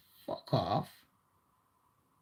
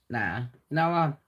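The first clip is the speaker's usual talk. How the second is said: ordinary delivery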